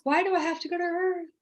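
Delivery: high-pitched imitated plea; reluctant plea. Asking the light being about Earth, reluctant plea. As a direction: ask it high, not wanting to go.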